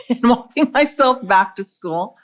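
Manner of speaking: real laugh breaking into the memory. Real, not acted. Laughs while recalling childhood walk.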